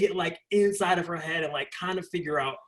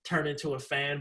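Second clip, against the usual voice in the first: ordinary talk, nothing marked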